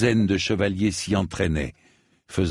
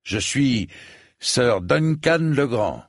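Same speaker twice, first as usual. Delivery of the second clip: higher, slow and firm on his own name; proud Dunk; 5 semitones higher, slower than usual. A narrator's reading. Dunk proudly gives his name to the knight, slow and firm. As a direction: announce yourself with dignity.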